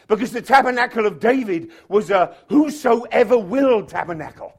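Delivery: loud, high, building preacher's cry; fired-up sermon delivery; full-force preaching. Real, not acted. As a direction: preach it with punched, rolling phrases.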